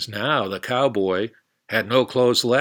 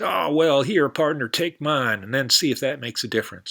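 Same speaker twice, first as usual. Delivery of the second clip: higher, louder cowboy voice; eager cowboy, higher and louder; hearty cowboy; 5 semitones higher, 5 dB louder than usual. Acted. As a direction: boom it out like a big-hearted cowboy. Cowboy character's lines.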